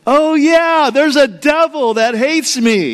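the penny drops all at once; sudden realisation. Retold or imitated. Realising on the phone that the devil hates him, a sudden high surprise.

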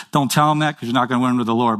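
ordinary talk

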